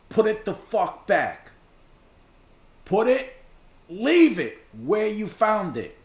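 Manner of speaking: loud, high, emphatic scolding; exasperated command. Real, not acted. Reactor warning people off buried finds, exasperated command. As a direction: order it firmly, punching each phrase.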